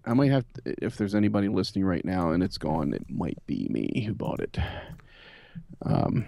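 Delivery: ordinary delivery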